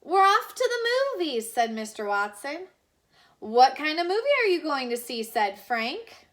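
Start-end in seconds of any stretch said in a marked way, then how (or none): 0.0-1.5 much higher and louder, singing out; gleeful reply. Acted. Husband calling to the children, high and gleeful. Mister Watson answers Stella. 3.4-5.2 fast and louder, tumbling words; eager boy. Acted. Little boy asking the neighbors, fast and eager.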